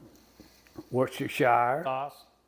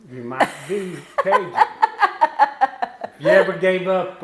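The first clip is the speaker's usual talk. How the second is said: chuckling through a joke; words break into laughter; laughing; 6 semitones higher, 9 dB louder, faster than usual. Real, not acted. Jokes "you might be Cajun.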